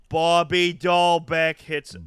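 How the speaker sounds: put-on slow, loud Boston accent. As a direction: drawl out the name in Boston accent. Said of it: "better names to say in a Boston accent".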